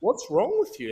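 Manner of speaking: mock the mate's puzzled question; mate's voice, mimicked. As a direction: teammate asking what's wrong, voiced high. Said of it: "And he goes".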